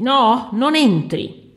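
loud, high, drawn-out protest; alarmed refusal. Acted. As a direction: stop him with a slow, loud cry. Housekeeper blocks the door. (grido allarmato e prolungato)

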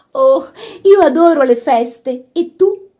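high, loud excited gush; breathless. A narrator's reading. Laura hugging her brother before the party, breathless. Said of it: "disse ansimante".

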